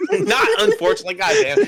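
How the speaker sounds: helpless, very high-pitched laughing voice; cracking up mid-sentence. Real, not acted. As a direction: squeak the words out through laughter. Cracking up at own slip.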